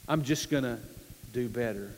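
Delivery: low, soft, halting resolve; acted resignation. Struggling believer promising himself to improve, quietly resigned. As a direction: let the promise sink almost to nothing.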